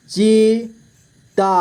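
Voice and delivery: चीता split slowly into ची, ता; long gap between ची and ता in चीता; syllable by syllable. Shown as a demonstration. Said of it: "तो च से ची बन गया". (चीता को तोड़कर धीरे: ची, ता)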